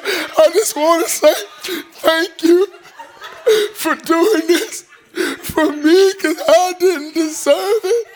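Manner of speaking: high, loud, laughing through tears; tearful thanks. Retold or imitated. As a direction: choke up with grateful laughter, slowing down. Thanking teacher who passed him.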